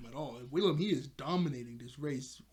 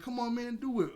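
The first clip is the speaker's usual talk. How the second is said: high, raised-pitch urging; pressuring; 9 semitones higher, 3 dB louder than usual. Retold or imitated. Friend pressuring the reluctant climber, urging. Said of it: "everybody keep pressuring him".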